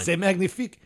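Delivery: high, hammy French chef exclamation. Pretend. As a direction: gush it like a proud French chef. Mock French praise of canned cranberry.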